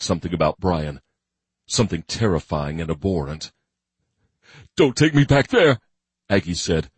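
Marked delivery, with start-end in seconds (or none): 4.8-5.8 loud begging. A narrator's reading. Captive man begging.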